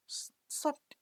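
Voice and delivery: whispered high disbelief; incredulous whisper. Acted. Strict classmate stunned at sleeping in, whispered. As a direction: gasp the word in disbelief.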